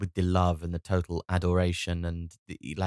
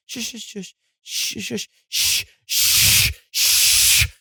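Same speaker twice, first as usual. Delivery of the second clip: high, then loud hissing shushes; frantic shushing; 14 semitones higher, 8 dB louder, much slower than usual. Retold or imitated. Dad shushing a baby that won't stop crying, getting frantic. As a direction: shush harder and harder, losing patience. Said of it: "this shush becomes like".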